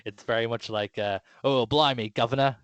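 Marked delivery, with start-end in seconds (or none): 1.4-2.6 hammed-up Cockney, higher and louder; jokey fake Cockney accent mocking the actress's attempt. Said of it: "kind of accent".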